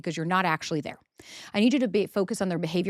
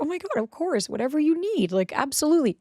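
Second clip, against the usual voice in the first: higher-pitched, readily obliging partner voice; imitating agreeable partner; 7 semitones higher than usual. Partner answering her request for help, retold eager agreement.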